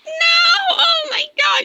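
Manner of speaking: high anguished cry of despair. Pretend. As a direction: cry out as your friend is lost. Character is consumed, crying out.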